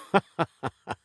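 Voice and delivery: short, high little laugh; self-satisfied chuckle; pleased chuckle. Acted. Pleased with the show just given, short chuckle.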